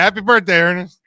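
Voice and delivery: loud, high birthday cheer; excited. Real, not acted. Echoed birthday wish.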